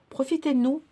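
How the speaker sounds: appuyé, plus haut et plus fort; insistant. Shown as a demonstration. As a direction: insister auprès des enfants. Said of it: "je le dis toujours à mes enfants". (insistance plus forte)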